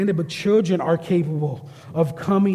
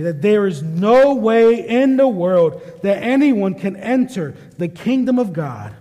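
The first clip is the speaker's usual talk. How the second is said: louder and slowed, each word driven home; emphatic declaration; 3 semitones higher, 5 dB louder than usual. Acted. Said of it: "very emphatic".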